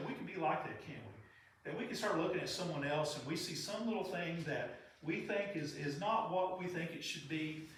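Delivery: ordinary delivery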